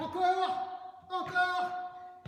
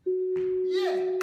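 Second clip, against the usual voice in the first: excited cheer; 2 semitones higher, 7 dB louder than usual. Real, not acted. Coach cheering during workout.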